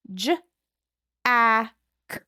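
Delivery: "J, a, k" each sound alone; sounding out phonemes. Shown as a demonstration. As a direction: break "Jack" into single sounds. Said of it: "how many sounds do we hear".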